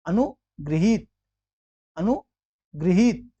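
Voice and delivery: अनुगृहीत drawn out, pausing after अनु; अनु, pause, then गृहीत with a clear "ri"; careful syllable demo. Shown as a demonstration. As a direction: say अनुगृहीत slowly in two parts, long ही. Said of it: "इसमें नीचे री लगा है".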